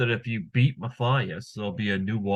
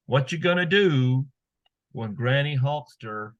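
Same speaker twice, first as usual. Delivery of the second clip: slow, drawn-out hype line; wrestling promo catchphrase; 2 semitones higher than usual. Acted. Host signature catchphrase.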